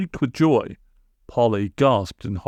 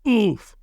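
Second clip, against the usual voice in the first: high winded oof; knocked breathless by the landing; landing grunt; 11 semitones higher than usual. A narrator's reading. Friday landing on a stone floor, a high oof. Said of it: "as he landed on a hard".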